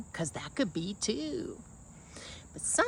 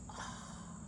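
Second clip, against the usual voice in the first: breathless oh; 17 dB quieter than usual. Retold or imitated. Sun reaction, person one.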